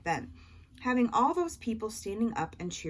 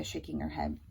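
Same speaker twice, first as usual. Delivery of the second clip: plain reading voice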